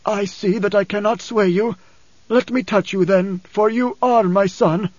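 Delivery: weary father yielding to his son; Paul, resigned. A narrator's reading. Narrator voices Paul giving in.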